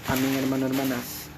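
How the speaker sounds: loud, ringing sermon voice; fervent preaching. Acted. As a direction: proclaim it to the congregation. Preaching at full force.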